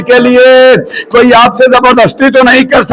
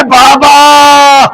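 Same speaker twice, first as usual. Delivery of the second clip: slow, higher, sobbing plea; crying child; 5 semitones higher, slower than usual. Retold or imitated. Sakina wakes on Karbala's dust crying to her father. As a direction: sob out the child's sleepless complaint. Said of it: "अचानक जाग के कहती है".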